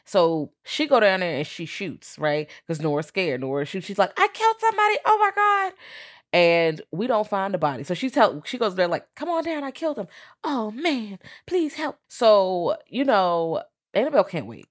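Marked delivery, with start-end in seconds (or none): 4.2-5.7 high, loud panicked cry; mock panic. Acting out Nora panicking after shooting. 9.2-12.0 high-pitched mock distress; acted plea for help. Acting out her plea for help.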